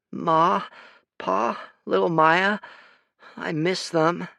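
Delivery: put-on high, tearful child voice; a small boy missing his family; grieving boy. A narrator's reading.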